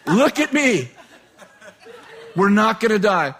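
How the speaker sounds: lightly acted older man, loud and insistent. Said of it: "He said".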